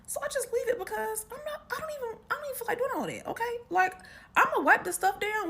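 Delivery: high, fast, fed-up aside; exasperated. Real, not acted. Telling listeners she can't be bothered, high and fast. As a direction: rattle it off, done with it all.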